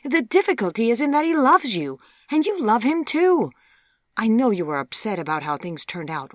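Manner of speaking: loud and high outburst, settling into firm lecture; exasperated mother. A narrator's reading. Mother telling her stubborn daughter whom she loves, exasperated. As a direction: insist on it, exasperated.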